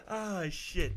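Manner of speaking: ordinary talk, nothing marked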